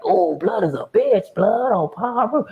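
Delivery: high slow mocking cellmate; scornful homie. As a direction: drag it out with scorn.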